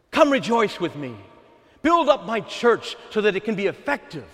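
exultant Father. Acted. Voicing the Father's plea.

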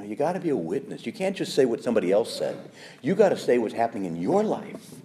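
ordinary delivery

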